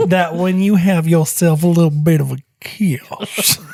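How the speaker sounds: mock southern accent, half whispered. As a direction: purr it in a hammed-up southern accent. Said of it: "That's my southern accent".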